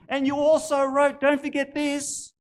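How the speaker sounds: high, loud, excited urging; urgent reminder. Acted. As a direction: press it on him with rising fervour. Woman urges the poet.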